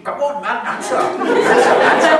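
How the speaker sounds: high, urgent egging-on, half laughing; high-pitched, loud hectoring, played for laughs; pushy peers. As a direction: imitate friends pressing hard for an answer. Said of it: "this peer pressure is just too much".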